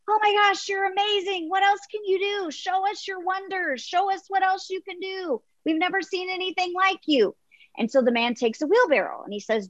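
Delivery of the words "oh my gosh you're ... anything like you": high-pitched, gushing amazement; cheering crowd. Acted. Crowd cheers the tightrope walker on, high and thrilled. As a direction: pitch way up, an awestruck crowd egging him on. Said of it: "cheering, and they're like".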